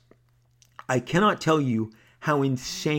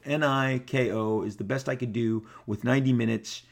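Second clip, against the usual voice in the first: ordinary delivery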